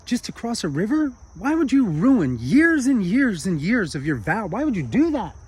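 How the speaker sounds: high, loud, stretched-out outrage; monk aghast at his friend, pleading and loud; outraged disbelief. Acted. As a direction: wail it like the vow is ruined. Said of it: "This is all in his mind".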